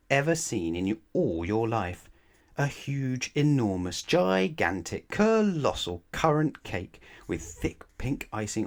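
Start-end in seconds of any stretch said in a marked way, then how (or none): 2.6-8.7 building wonder. A narrator's reading. Building cake description.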